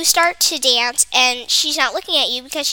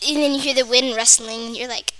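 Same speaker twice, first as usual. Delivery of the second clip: ordinary talk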